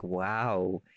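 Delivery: ordinary delivery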